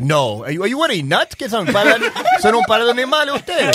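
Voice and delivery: laughing in shock at his friends; shocked disbelief. Retold or imitated. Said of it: "And I'm like". (incredulidad con risa, más agudo y fuerte)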